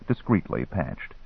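ordinary delivery, nothing marked

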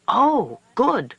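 high, bright sudden "oh, good"; eager exclamation. A narrator's reading. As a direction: jump at the idea eagerly. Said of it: "Coyote said".

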